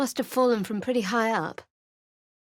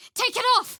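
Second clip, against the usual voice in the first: angry shout; 14 semitones higher, 3 dB louder than usual. Acted. Character confronts masked figure.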